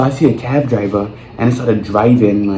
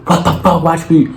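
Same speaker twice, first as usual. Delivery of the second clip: much higher mock-angry imitation; mocking the rude mother; 7 semitones higher than usual. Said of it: "You just wanna go".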